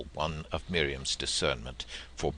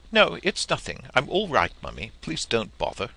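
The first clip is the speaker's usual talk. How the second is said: higher, louder, hurried reassurance; brushing it off; 7 semitones higher, 5 dB louder than usual. Acted. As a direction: wave the mother away quickly. Son brushing mother off.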